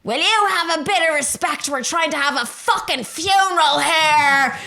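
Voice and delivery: high, loud, slow, mock-outraged demand for respect; indignant shout. Ma yelling up at the neighbour over the budgie funeral, indignant. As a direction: play the tipsy mother hollering back in protest.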